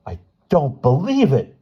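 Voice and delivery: high, loud delighted cry; delighted roar. A narrator's reading. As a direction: roar with joy over the din. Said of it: "Bruno roared delightedly".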